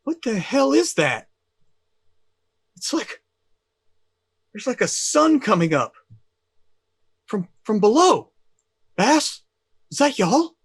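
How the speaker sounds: high, loud, astonished radio call; Barry on the radio. Acted. Barry radios the crew about a sun rising below, astonished. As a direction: call out in high-pitched disbelief, pausing between phrases. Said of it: "you hear, uh, Barry pop back on. He's like".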